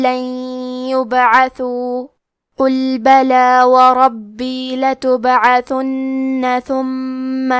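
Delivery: slow, melodic chanted Quran reading; full measured tilawat with every rule applied; tajweed recitation. Acted. Teacher recites the full passage for listeners with tajweed. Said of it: "تلاوت تجوید کے ساتھ سماعت فرمائیں".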